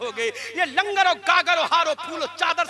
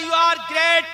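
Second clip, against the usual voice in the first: ordinary delivery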